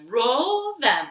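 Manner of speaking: ordinary delivery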